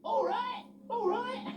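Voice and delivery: very high laughing reply. Acted. Freddie the forklift laughing after his crash. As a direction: laugh it off merrily. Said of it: "laughed Freddie Forklift".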